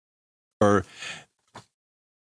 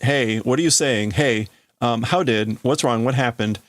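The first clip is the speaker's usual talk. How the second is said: usual speaking voice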